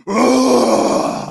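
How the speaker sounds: loud guttural dinosaur grunt; T-Rex. Pretend. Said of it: "I guess, would be like".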